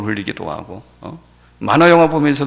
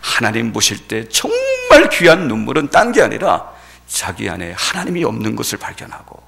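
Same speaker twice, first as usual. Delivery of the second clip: usual speaking voice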